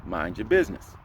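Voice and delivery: low, soft warning; inner warning message. Retold or imitated. Message in his head as he sees her cry, low and soft. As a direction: drop it low and quiet like a private thought. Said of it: "perfectly timed message that says".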